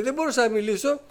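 ordinary delivery

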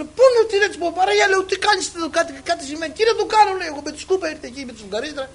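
high, agitated re-enacted panic; retold frantic shouting. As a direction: act out frantic shouting for help. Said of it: "και εκεί φώναζε".

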